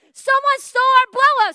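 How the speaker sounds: high, loud outraged report; indignant outburst. Retold or imitated. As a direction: blurt it out, fired up. Telling husband on the phone.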